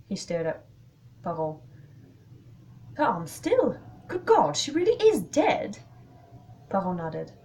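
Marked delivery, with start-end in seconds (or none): 2.9-5.8 high, loud horrified exclamation; pitched-up shock. Acted. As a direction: blurt it out in disbelief. Discovers girl is dead.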